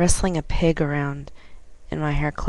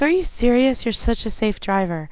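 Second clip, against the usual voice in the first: higher, bright astonished lady; surprised praise; 6 semitones higher, 5 dB louder than usual. Retold or imitated. Passenger hearing it's the driver's first fare, surprised. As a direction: gasp it out, amazed.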